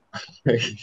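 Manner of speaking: a quick real laugh; short laugh. Real, not acted. Laughing at himself after retelling the therapist's blunt question.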